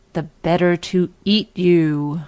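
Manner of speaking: put-on wolf revealing himself to the girl; menacing wolf. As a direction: let the wolf's hunger show. Wolf's final menacing line.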